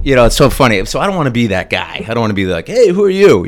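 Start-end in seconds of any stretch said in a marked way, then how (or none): 2.7-3.5 loud, higher mock greeting; pushy fan. Fan accosting the stranger beside him, mock pushy. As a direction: call it out like an over-eager fan.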